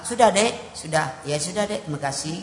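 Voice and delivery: soft, hushed soothing of a wife; softly calming an angry wife; gentle calming. Acted. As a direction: ease her down quietly. Husband gently calming a shouting wife.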